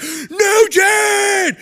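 very high, loud drawn-out cheer; crowd roar. Retold or imitated. Troops roaring for [name], very high and loud. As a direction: scream his name like a stadium.

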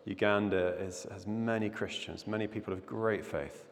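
low, hushed prayer voice; quiet earnest congregational prayer. Acted. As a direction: pray softly over the nation. Opening prayer before the sermon.

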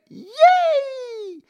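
mock cheer, squeaked very high; a fake, falsetto celebration; sarcastic yay. Mock cheer about fixed life.

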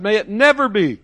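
high and emphatic; outraged exclamation. Acted. Preacher repeating Paul's shocked refusal, with outrage. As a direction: spit out the unthinkable. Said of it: "Paul said".

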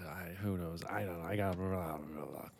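trailing off into a whisper; mumbling whisper. Real, not acted. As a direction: fade out, barely voiced. Trailing off, lost for words.

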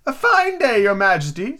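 usual speaking voice

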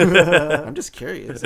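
laughing, teasing. Real, not acted. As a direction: giggle through it. Teasing after "don't question me.

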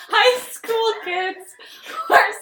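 high, slow laughing disbelief. Real, not acted. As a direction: squeal it through laughter.

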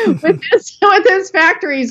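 real laugh breaking through the words, pitch jumping way up. Real, not acted. Guest tells host about the factory deal, laughing. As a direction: giggle through it as the memory tickles you. Laughing while recalling the story.

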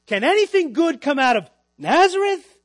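loud, high incredulous question, imitating the doubters; incredulous scorn. People scoffing at Jesus' lowly hometown, loud incredulous scorn. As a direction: throw it out as a mock-scandalized cry, peaking on Nazareth.

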